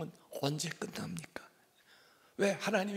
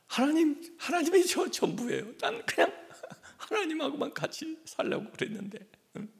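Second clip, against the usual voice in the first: pleading Jeremiah; 9 semitones higher than usual. Acted. Preacher voicing Jeremiah crying out to God, pleading higher. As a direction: beg God like a clinging child.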